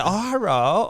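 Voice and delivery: high-pitched imitation Japanese accent; badly faked Japanese accent; mock Japanese accent. Shown as a demonstration. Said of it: "They have an accent. They say".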